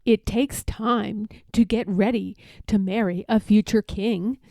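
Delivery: plain reading voice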